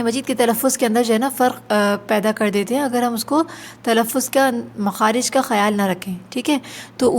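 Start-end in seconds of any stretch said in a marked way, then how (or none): none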